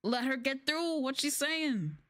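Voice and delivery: irritated colleague stepping in, drawn out; annoyed teacher. Retold or imitated. Japanese teacher telling the student to let her finish, annoyed and slowed down. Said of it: "would even be annoyed".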